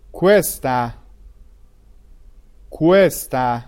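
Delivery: کوستا with an open o and falling tone; تلفظ کوستا با o باز; Italian costa. Shown as a demonstration. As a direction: model کوستا, o not u.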